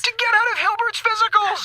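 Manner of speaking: high panicked shout back; yelling in alarm; panicked retort. Acted. Eiffel argues with the commander mid-attack, panicked retort.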